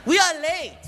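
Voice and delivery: high, slow impatient call; imitated impatient co-star; Jackie Chan. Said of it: "Jackie was there waiting".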